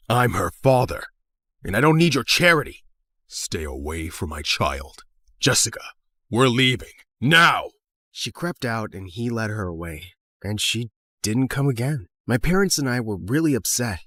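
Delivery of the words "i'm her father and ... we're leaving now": stern angry father. Acted. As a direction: order your daughter out, brooking no argument. Angry father takes his daughter away.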